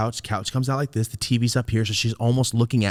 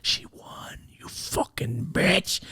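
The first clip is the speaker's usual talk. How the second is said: whisper; 10 semitones higher, 8 dB quieter, slower than usual. Retold or imitated. Secretly cursing mom in whisper.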